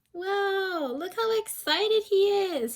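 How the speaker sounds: high, bright-eyed wonder; excited wow. Acted. Teacher shows children how excited Rainbow is. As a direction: share the thrill with the kids.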